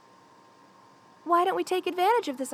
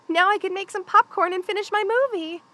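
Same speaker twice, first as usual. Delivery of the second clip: normal talking voice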